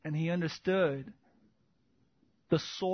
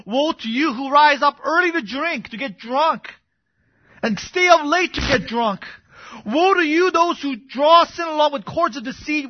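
loud, high preaching at full force; impassioned pulpit cry, hammering each charge; prophet's denunciation; 6 semitones higher, 11 dB louder than usual. Acted. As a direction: thunder the woes at the congregation.

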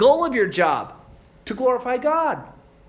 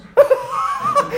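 hearty squealing laugh; loud high laugh; 6 dB louder than usual. Real, not acted. Big laugh in story.

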